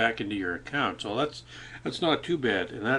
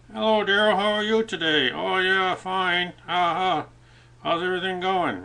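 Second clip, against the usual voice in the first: high, louder puppet voice greeting its owner; ventriloquist puppet voice; 9 semitones higher, 5 dB louder than usual. Acted. Puppet greets Daryl in a high ventriloquist voice. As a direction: play the puppet chatting cheerfully to Daryl, pitched way up. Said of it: "do some ventriloquism".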